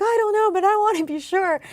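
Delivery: high, loud re-enacted answer; urgent acted reply. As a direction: blurt it out, high and eager, as a retold reply. Acts out her anxious past reply.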